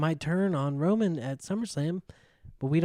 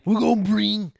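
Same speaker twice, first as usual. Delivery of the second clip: John Cena impression; 7 semitones higher, 7 dB louder than usual. Cena impression in wrestling talk.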